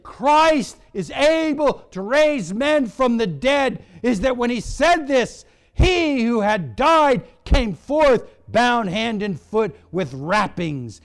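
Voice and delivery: shouted, high sermon climax; loud, ringing proclamation; full-force preaching. Real, not acted. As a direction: preach it at a shout, punching key words.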